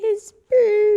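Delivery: put-on high, loud kid voice; mock toddler. Mom voicing the toddler in a high, loud chirp.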